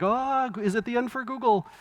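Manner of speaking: high, hammed-up worried voice; mock alarmed media. Speaker mimicking the media over Google, mock alarm. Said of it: "And the media's like".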